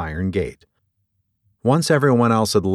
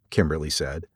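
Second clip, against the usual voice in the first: ordinary delivery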